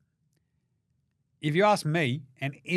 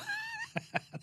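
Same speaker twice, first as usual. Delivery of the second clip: high squealing laugh; 12 dB quieter than usual. Real, not acted. Laugh after cheering.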